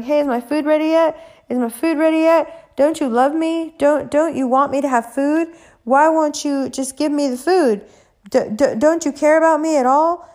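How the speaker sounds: high, needy pleading; whiny pestering. Retold or imitated. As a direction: nag like a spoiled child. Pestering the chef for food.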